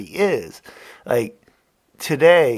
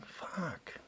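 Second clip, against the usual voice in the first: whispered curse; 2 semitones higher, 17 dB quieter than usual. Real, not acted. Frustrated aside.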